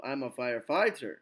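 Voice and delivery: "firefighter" with FIGHT stressed, then dropping; imitated learner's stress. Teacher imitating non-native speakers, "firefighter" stressed on FIGHT. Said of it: "a lot of people".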